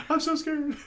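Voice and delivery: tiny, very high hostage voice; hammed-up frightened whimper; mock scared. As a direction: squeak it softly in pretend fear, half laughing.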